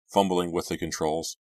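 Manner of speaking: ordinary delivery, nothing marked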